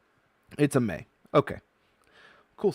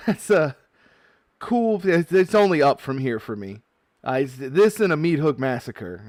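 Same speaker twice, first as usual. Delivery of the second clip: loud, high, thrilled yelling; excited shouting; 7 semitones higher, 9 dB louder than usual. Real, not acted. Opening a pack for viewers, shouting loud and thrilled. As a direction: shout it out like a jackpot win. Pulls rare Japanese foil card.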